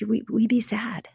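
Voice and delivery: hushed and gentle, slowed right down. Retold or imitated. Husband tells his scared wife how to cope, hushed and slow. Husband answers her panicked question.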